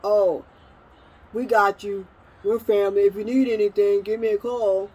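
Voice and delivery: loud, sugary mock reassurance; loud mock sweet promise, sneering; mocking fake promise. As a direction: ham up the empty family promise. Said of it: "people saying".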